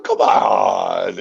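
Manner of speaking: drawn-out mock protest; mock exasperation. Friend always killed first in the game, retold mock exasperation. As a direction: stretch out a retold, exasperated complaint.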